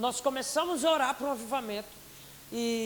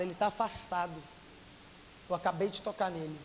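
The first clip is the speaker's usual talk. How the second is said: lower, quieter divine voice with pauses; Holy Spirit speaking; 5 semitones lower, 5 dB quieter than usual. Retold or imitated. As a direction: speak low and measured, as God instructing. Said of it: "O Espírito Santo me fala". (voz do Espírito Santo, baixa e pausada)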